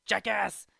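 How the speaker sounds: loud mock shout. Shooter yelling at the jackass gravestone. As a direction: bark the name out.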